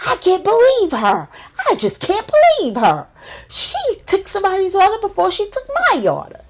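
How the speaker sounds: high, loud, outraged squeal; ranting drunk friend. Retold or imitated. Drunk friend ranting in car about her order, high loud squeal.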